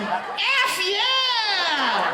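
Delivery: very high, drawn-out whoop; long childlike high-pitched cheer; Alphonse. Acted. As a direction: squeal it out jubilantly. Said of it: "Imagine Alphonse going".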